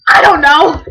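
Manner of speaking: loud exasperated cry; exasperated outburst. Real, not acted. Kid gives up explaining to viewers, exasperated shout. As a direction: throw up your hands out loud.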